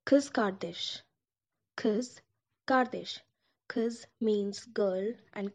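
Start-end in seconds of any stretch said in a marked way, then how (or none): 0.1-3.2 "Kız kardeş" with a sharp k and a buzzing z, kiz kar-desh; Turkish kız kardeş. Shown as a demonstration. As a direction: stress "Kız" then "kardeş". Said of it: "it will sound like this".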